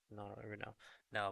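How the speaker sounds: ordinary talk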